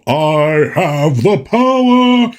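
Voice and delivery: slow, drawn-out heroic declaration with echo; cartoon He-Man cry. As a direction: stretch it out like an echoing cartoon hero. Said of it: "they deepened his voice a little bit".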